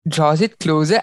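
ordinary delivery

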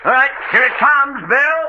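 very high, projected call; high rescue holler. Acted. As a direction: call across the water to a man overboard. Throwing rope to man overboard.

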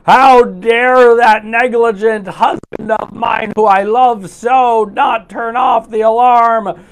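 slow, loud, high furious rant; theatrical indignant shout; mock outrage. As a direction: ham up an angry wife fuming at her husband. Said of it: "Instead of going".

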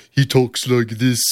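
slow, faux-English demo; weird English voice. Shown as a demonstration. Host demonstrates the guy's failed Stewie accent, slow. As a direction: put on a bad almost-English lilt. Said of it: "almost English voice where he".

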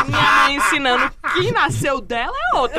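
laughing. Real, not acted. Laughing complaint about mom.

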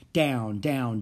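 plain reading voice

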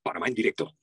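low announcer voice; deep promo read; station announcer tag. Shown as a demonstration. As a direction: voice a live-broadcast ID, low and measured. Demonstrates his announcer tag lines.

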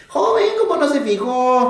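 high, slow mock Puebla accent. Puebla worker scolding the trucker, slow and high in a Puebla accent. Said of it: "Ellos tienen un acento medio distintivo".

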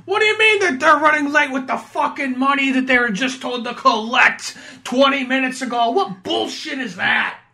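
high, loud fed-up tirade; hammed-up outrage; mock angry rant. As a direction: rant like an exasperated New Yorker. Voicing angry character.

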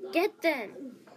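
loud, high-pitched order; yelled command. A narrator's reading. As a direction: shout the attack order at servants. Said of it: "she yelled".

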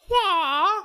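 high, loud martial-arts "wah"; imitated fight cry. Patrick Swayze striking with a claw hand, high attack cry. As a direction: let out a sudden mock attack yell. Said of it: "He's like".

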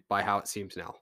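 ordinary talk

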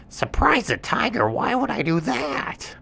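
high, questioning monkey voice; monkey surprised by the request; puzzled monkey. Acted. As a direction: ask it high and baffled. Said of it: "And the monkey said".

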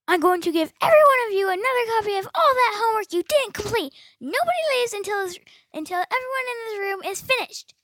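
shrieking punishment at the students; monster teacher rage. Acted. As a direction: bellow it like a furious monster teacher.

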